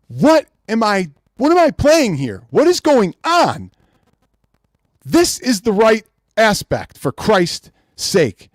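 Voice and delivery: high, slow outraged disbelief; can't believe what he's seeing; exasperated. Real, not acted. Angry at the wrong aspect ratio.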